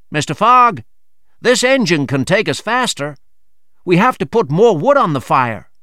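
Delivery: hint of a keen seaman pressing Fogg; eager seaman. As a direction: urge the captain on like a keen deckhand.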